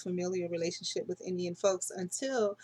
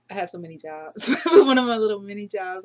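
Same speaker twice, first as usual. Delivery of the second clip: real laugh breaking into the words, louder; 2 semitones higher, 12 dB louder than usual. Real, not acted. Recalling her many little mini jobs, laughing. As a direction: laugh through the line.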